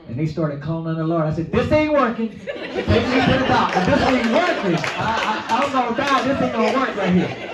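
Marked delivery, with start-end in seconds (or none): 1.5-7.5 loud, high, desperate pleading with a laugh in it; panicked yell. Retold or imitated. Telling the praying aunts in a loud, high panic. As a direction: shout it like you think you're dying.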